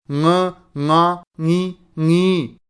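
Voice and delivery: slow, drawn-out ङ and ङी with the nasal held; syllable drill. Shown as a demonstration. Teacher demonstrating ङ syllables to students, slowly. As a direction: hum the ङ sound deliberately. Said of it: "इस प्रकार इसका उच्चारण होगा".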